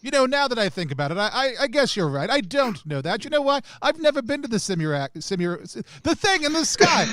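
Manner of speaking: put-on high, fast posh duchess accent; high put-on noble accent. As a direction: gush like a flustered aristocrat. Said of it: "I cannot say it in that accent".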